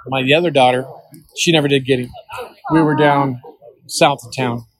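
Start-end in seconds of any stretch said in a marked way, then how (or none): none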